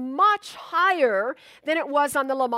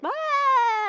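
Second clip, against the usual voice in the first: imitated child's cry; 10 semitones higher, 4 dB louder than usual. Hurt child cries out.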